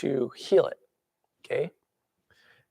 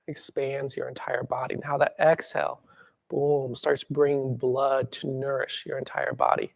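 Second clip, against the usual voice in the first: guided breathing. Shown as a demonstration.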